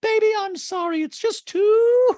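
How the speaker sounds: very high, loud, stretched gush with hooting; loud squeaky caricature. Retold or imitated. Showing off an outfit, loud high hooting gush. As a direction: gush it high, drawing out the last word. Mimicking Dorit's fashion show, flashback.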